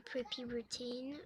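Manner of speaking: "routine" slipped into English pronunciation; English accent. Real, not acted. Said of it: "je fais l'accent anglais". (« routine » prononcé avec l'accent anglais)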